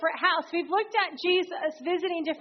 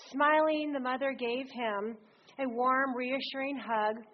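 ordinary delivery

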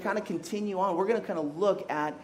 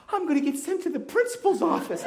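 put-on much higher, worried kid voice; little girl voice; 11 semitones higher than usual. Daughter worrying to dad about the principal, higher. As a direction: sound like a scared kindergartner. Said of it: "And she's like".

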